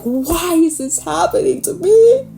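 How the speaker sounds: high, whiny wailing why; mock sobbing complaint. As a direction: ham up a crying breakdown. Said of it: "He's like".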